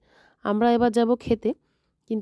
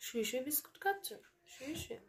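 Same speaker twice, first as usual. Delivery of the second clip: much softer, higher playful question; hushed teasing; 4 semitones higher, 20 dB quieter than usual. Real, not acted. Gently teasing the lying baby. (ফিসফিস করে আদুরে প্রশ্ন)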